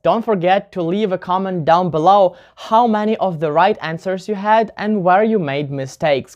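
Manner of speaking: normal talking voice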